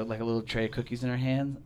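ordinary delivery

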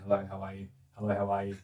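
soft, restrained polite greeting; hushed, formal hellos; polite Obama greeting. Retold or imitated. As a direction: imitate a reserved politician working down a line.

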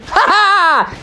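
high mock robot laugh; Techno Bear. Robot bear pops out of the garbage can, high triumphant voice. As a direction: burst out with a high ha-ha. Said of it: "he popped out and said".

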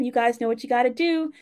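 usual speaking voice

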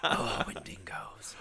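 hushed spooky whisper. Acted. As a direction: breathe it out slowly, ominously. Spooky aside about Wendigos.

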